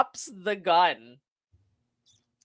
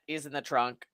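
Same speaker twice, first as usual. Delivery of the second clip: deadpan; 7 semitones lower, 11 dB quieter than usual. Retold or imitated. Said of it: "they're like".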